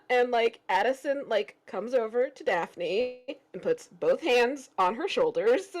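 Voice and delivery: usual speaking voice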